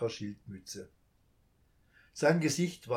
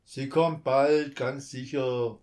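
slow, louder, steady reassurance; firm reassurance; 9 dB louder, slower than usual. A narrator's reading. Thief reassures the child, firm and slow. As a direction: promise it firmly and slowly. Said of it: "versicherte der Einbrecher mit fester Stimme".

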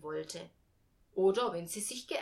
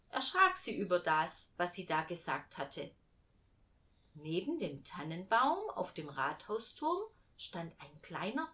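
usual speaking voice

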